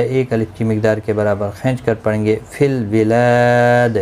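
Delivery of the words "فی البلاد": slow فی البلاد, the لا drawn one alif; tajweed demo. Shown as a demonstration. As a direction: stretch the لا of البلاد one count. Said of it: "لا الف مدہ ہے".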